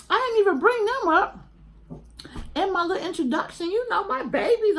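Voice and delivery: high, bright gush about her dogs, breaking into a laugh; excited, laughing. Real, not acted. To viewers about her little Shih Tzus, giddy and laughing.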